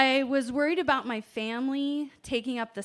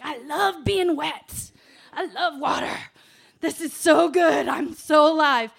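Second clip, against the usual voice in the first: high, loud, overjoyed gush; ecstatic relief; 5 semitones higher, 10 dB louder than usual. Retold or imitated. Lying in the boat, rescued: high, loud joyous gush. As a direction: burst with joy at being alive. Relief after being pulled out.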